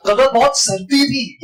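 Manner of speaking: सर्दी lifted high and punched; stress on सर्दी. Shown as a demonstration. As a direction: make सर्दी the news. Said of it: "यहाँ सर्दी हो गई". (सर्दी पर ऊँचे सुर में ज़ोर)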